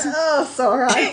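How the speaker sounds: laughing. Real, not acted. Laughing mid-reading.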